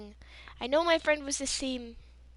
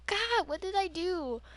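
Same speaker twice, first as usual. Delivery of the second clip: higher, indignant complaint; exasperated protest; 7 semitones higher than usual. Retold or imitated. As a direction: whine back at an unfair scolding. Retorting to the scolding teacher.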